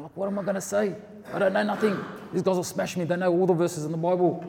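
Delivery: high, worried whine of self-doubt; panicked inner voice. Retold or imitated. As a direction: plead nervously, pitched well up. His panicked inner reply to angel.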